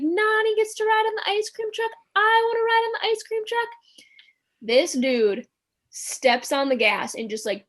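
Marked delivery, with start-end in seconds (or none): 0.0-3.7 put-on high, loud little-boy whine; whiny kid yelling. Little brother protesting that he can't ride the truck, high whiny yell. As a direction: wail it like a jealous toddler. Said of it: "he's like".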